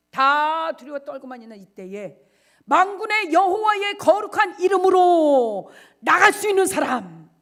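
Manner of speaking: loud, high, slow sermon cry; full-force preaching. Acted. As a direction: preach it out with fire.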